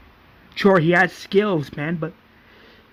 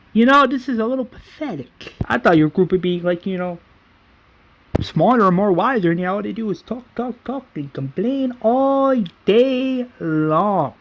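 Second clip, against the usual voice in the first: higher-pitched scornful lecture, acted out; mocking complaint; 6 semitones higher than usual. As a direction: belittle the group, stretching the ending. Merle mocking the group.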